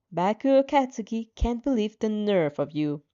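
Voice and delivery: plain reading voice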